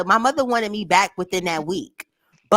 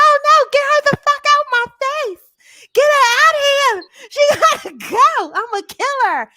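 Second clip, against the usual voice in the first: high, loud, crying shriek; hysterical mother; 4 dB louder than usual. Retold or imitated. Mother shrieking to throw her daughter out, crying and loud. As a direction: wail it like a mother losing it completely. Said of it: "She's like".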